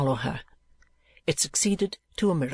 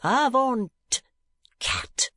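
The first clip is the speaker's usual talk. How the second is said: loud and sonorous; 9 semitones higher, much slower than usual. A narrator's reading. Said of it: "in a loud and sonorous voice".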